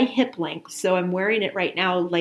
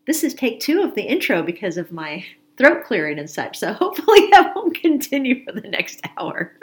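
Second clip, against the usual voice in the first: laughing; 4 semitones higher, 5 dB louder than usual. Real, not acted. Laughing at her own throat clearing.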